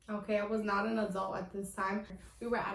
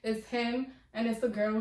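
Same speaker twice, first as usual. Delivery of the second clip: ordinary talk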